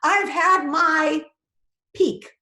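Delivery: hammed-up rapture; mock ecstasy. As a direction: ham up a peak of pleasure. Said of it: "you're in ecstasy".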